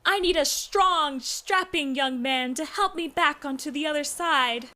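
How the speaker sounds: high, breathless damsel plea; fluttering cries for help. Pretend. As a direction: plead high like a helpless damsel. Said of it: "are you pretending to be a damsel in distress".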